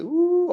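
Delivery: high mock crowd ooh; a swooping ooh from the crowd. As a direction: imitate the team's high ooh. Team reacting to the big hit.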